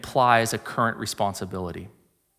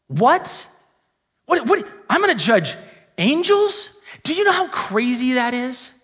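high-pitched, stunned disbelief; incredulous, rising outburst; acted shock; 9 semitones higher than usual. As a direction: blurt it out as if floored by the news. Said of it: "it's supposed to shock you".

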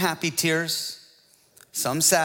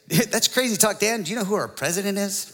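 higher, livelier mock objector; lightly hammed-up challenger, higher voice; opposing objector; 3 semitones higher than usual. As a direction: imitate the other side protesting, pitch up. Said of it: "The other side would say".

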